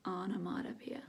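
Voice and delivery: whispered. Shown as a demonstration. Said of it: "Can you say it?".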